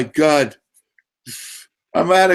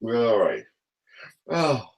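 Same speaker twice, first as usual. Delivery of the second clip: gasping softly for air; real breathlessness after a laughing fit; out of breath; 2 semitones lower, 8 dB quieter than usual. Real, not acted. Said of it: "I'm sucking for air".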